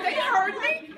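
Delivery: high and loud, laughing indignation; laughing protest. Retold or imitated. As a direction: squeal it out high, half laughing.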